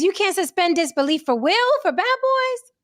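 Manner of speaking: incredulous, high-ended question; incredulous challenge. Real, not acted. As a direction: pitch climbing high into the question. Challenging co-host's refusal to suspend disbelief.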